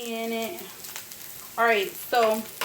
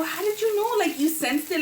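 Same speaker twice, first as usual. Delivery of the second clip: amazed; 8 semitones higher than usual. Retold or imitated. Retells own amazed question.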